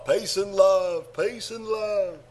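put-on slow, drawn-out Beatle catchphrase in Liverpool accent; [name]. Feeding co-host a line to copy, drawling Liverpool accent. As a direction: stretch it out in mock Scouse. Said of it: "by you, [name]".

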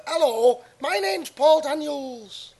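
high, slow magician impression; Paul Daniels. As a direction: pitch it up and stretch the name. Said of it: "He sort of talks in a kind of".

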